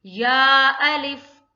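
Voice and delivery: या अलिफ with या drawn out for two beats, loud and slow; tajweed recitation. Shown as a demonstration. Teacher shows students how to recite, loud slow chant. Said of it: "कैसे करेंगे?".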